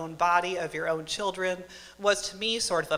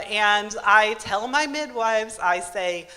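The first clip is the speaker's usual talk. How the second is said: usual speaking voice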